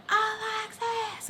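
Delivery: very high, drawn-out scream of a name; thin, high mock scream down a phone; imitated screaming. Friend screaming into the phone, imitated scream. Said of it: "like, screaming, right?".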